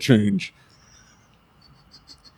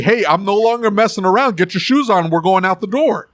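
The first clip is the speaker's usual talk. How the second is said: raised-pitch, no-nonsense parent; firm dad voice; 6 semitones higher than usual. Shown as a demonstration. Dad hurrying his kids out the door, firm dad voice. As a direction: lay down the law like a dad. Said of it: "so you know the dad voice".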